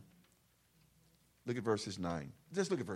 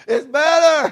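very high, loud preacher's cry; exultant shout; 21 dB louder, slower than usual. Real, not acted. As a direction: shout it out to the congregation. Preacher exclaims to teased listener.

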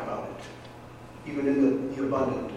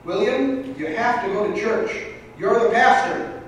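higher, louder fed-up mother; exasperated; 6 semitones higher, 4 dB louder than usual. Acted. Mother finally telling her son he must go, exasperated and higher. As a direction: lose patience on the punchline. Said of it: "in a somewhat exasperated voice".

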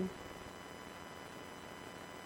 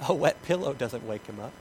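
ordinary talk, nothing marked

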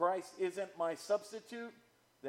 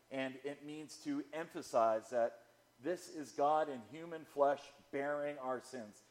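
ordinary delivery

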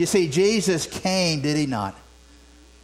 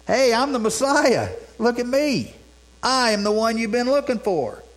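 triumphant shout; 7 semitones higher than usual. Pretend. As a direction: announce it like a winner. Risen Jesus proclaiming himself.

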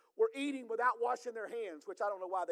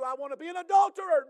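mock confession; 6 semitones higher, 10 dB louder than usual. Mocking an imagined confession.

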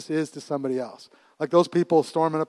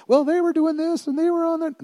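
much higher mocking teen voice; mock whiny excuse; 13 semitones higher than usual. Dad mimicking daughter blaming friends for a crash, mock whine.

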